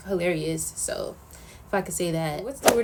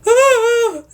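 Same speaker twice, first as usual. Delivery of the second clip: high startled shriek, louder; dumb scream; 13 semitones higher, 10 dB louder than usual. Retold or imitated. Showing how she screams at a jump scare, a sudden high shriek. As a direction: shriek like you just got jump-scared. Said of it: "dumbest scream when I'm really scared".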